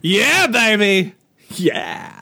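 cheesy spy-parody catchphrase, slowed right down; groovy spy catchphrase. Pretend. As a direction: purr it like a cheesy sixties spy, then whisper the second one.

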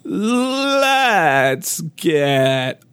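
put-on very slow, raspy old-man drawl. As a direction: drag it out like a creaky old turtle. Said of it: "That's my old man voice".

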